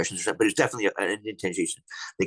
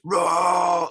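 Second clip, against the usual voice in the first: loud, higher imitation of a guttural roar; mock roar; 8 semitones higher, 5 dB louder than usual. Telling the host about the forest roar, mock roar. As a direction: belt out the creature's roar.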